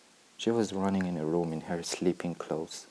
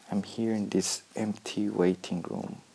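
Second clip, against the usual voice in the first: ordinary delivery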